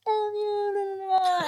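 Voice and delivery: high, whiny wordless-sounding imitation; high sustained mock wail; mock protest. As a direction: mimic a worked-up boyfriend.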